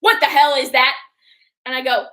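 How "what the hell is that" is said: loud, sharp mock outrage; grandma catching sight of the tattoo, loud; grandma's outraged question. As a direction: imitate grandma snapping at the tattoo. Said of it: "She says it just like that".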